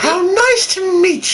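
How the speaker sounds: high slow Dobby voice dropping off at the end. Acted. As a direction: put on a squeaky house-elf voice. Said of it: "I'm not gonna do that voice anymore".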